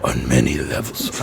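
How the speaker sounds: low gruff Scandinavian man; deep gruff murmur. Retold or imitated. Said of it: "he has, like, a gruff voice".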